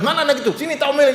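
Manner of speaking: high, half-hearted mock outburst; mock angry parent. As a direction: play the hot-headed parent ready to scold, not for real. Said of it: "jangan kita bilang".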